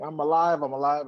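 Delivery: elated mock yell; retold joyful shout. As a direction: shout it like you just woke from death. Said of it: "I'm screaming from the top of my".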